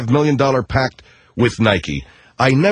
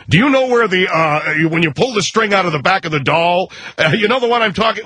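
higher, hurried frantic customer; frantic shopper; 5 semitones higher than usual. Retold or imitated. Pushy parent hounding the toy clerk, frantic and high.

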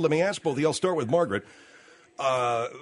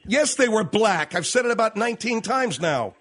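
higher, exasperated insistence; fed up; 5 semitones higher than usual. Real, not acted. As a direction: lose patience after repeating yourself. Fed up repeating the answer.

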